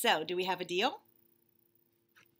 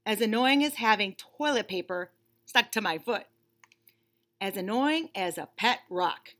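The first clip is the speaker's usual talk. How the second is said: lightly voiced bunny, impatient and fed up; a put-upon bunny stuck waiting; bored bunny; 3 semitones higher, 5 dB louder than usual. Bunny complaining to the reader about waiting, mildly whiny.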